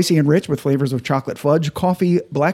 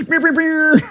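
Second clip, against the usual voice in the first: mocking voice; 12 semitones higher, slower than usual. Mocking Ed's pronunciation.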